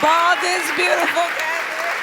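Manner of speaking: loud, high echoing cheer; excited cheer. Real, not acted. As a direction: cheer her on from the stage. Host echoes the cheer.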